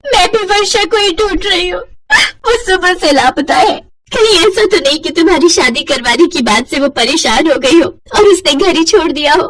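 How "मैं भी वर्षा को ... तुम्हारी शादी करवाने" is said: fake crying. Nisha lying to her husband, a hammed-up weepy voice. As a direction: pretend tearful to cover the lie.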